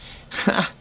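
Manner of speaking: breathy short laugh; a quick breathy laugh. Real, not acted. Laugh after the uncle's news.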